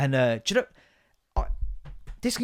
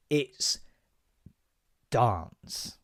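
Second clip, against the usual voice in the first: slow, low "dance" with long AH; pause, then place "dance" down firmly; stern correction; 5 semitones lower, 6 dB quieter than usual. Acted. Parent not raising a northerner, stern measured correction.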